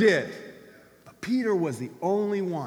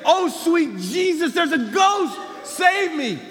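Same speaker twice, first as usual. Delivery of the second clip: high, loud hammed-up scream for help; mock panic, yelled out high; terrified shout; 12 semitones higher, 8 dB louder, slower than usual. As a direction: shout like you've seen a ghost on the lake. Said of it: "You would say".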